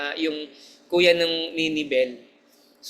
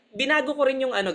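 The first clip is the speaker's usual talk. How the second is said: normal talking voice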